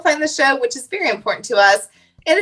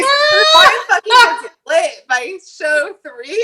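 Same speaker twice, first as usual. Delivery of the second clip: excited shout; 7 semitones higher, 5 dB louder, much slower than usual. Real, not acted. Excited story about drunk friend.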